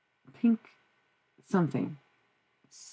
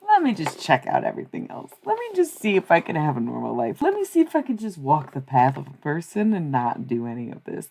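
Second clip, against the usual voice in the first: stalling, bargaining with oneself; 8 dB louder than usual. Retold or imitated. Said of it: "I just was like".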